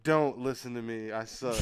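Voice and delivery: soft, self-deprecating mumble, trailing down; quiet, deflated admission; sheepish retelling. Retold or imitated. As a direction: shrink away from the mic's attention. Retells sheepish plea on mic.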